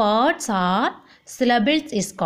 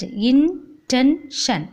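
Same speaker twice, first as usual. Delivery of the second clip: slow "intention" in three separate beats; split into syllables; much slower than usual. Shown as a demonstration. Teacher splitting the word for class, slow syllable by syllable. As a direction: pause between each syllable of "intention". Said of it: "split the word".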